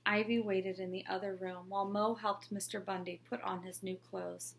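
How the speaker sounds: usual speaking voice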